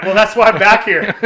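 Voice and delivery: loud, laughing through the words; a loud laughing confession. Real, not acted. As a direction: crack up while telling the punchline. Laughing at his own failure.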